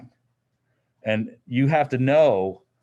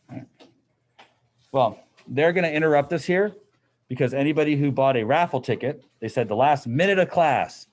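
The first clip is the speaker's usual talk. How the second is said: normal talking voice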